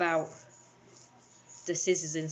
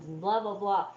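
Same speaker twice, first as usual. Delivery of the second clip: usual speaking voice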